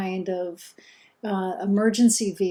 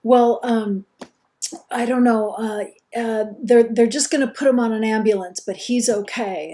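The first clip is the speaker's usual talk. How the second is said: imitating shaken son; 3 semitones higher, 7 dB louder than usual. Son explaining ambulance to mom, lightly voiced, faltering. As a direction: stumble through it like a scared kid. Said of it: "part stuttering, part crying".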